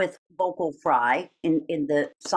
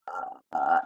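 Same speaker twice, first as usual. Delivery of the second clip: low quiet creaky fry; soft crackle with little air; vocal fry; 3 dB quieter than usual. Shown as a demonstration. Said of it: "that doesn't sound the same".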